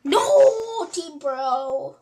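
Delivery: high, loud drawn-out cry; protesting yell. Real, not acted. Player reacting to a setback in the game, protesting yell. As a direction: yell it high, then let it trail down.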